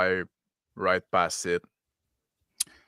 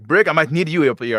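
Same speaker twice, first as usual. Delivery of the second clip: louder, higher call to a crewmate; yell over the shoulder; 5 semitones higher, 7 dB louder than usual. Acted. Pilot calling a crewmate up front, raised voice. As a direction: call back for help from the controls. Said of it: "I yell over my shoulder".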